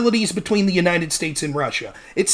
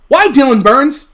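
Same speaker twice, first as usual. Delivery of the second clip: usual speaking voice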